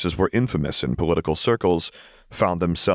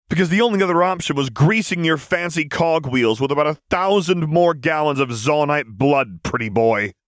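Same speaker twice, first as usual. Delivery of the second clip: higher, rough street voice, sneering; tough Vi; 10 semitones higher, 4 dB louder than usual. A narrator's reading. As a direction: spit it at him with contempt. Vi furiously confronts the councilor.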